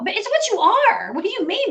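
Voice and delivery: normal talking voice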